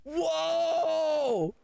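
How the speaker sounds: high cheering whoa; excited shout. Acted. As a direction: cheer like a crowd. Mock crowd cheer.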